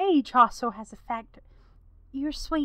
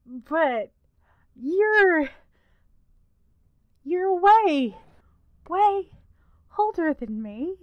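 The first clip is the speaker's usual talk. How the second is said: flustered, louder and higher; 5 semitones higher, 6 dB louder, slower than usual. Acted. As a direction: stammer it out, caught off guard. NPC flustered at unwanted confession.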